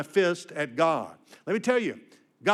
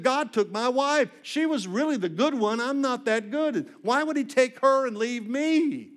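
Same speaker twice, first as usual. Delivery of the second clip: higher, slower, pleading grief; a touch of a grieving husband's lament; emotional widower; 8 semitones higher, 3 dB louder than usual. Retold or imitated. Widower asking the preacher why God took his wife, emotional and pleading. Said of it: "he was very emotional".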